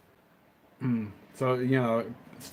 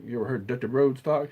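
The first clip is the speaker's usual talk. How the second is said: ordinary talk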